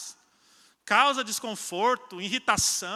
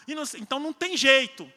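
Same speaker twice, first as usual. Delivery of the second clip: normal talking voice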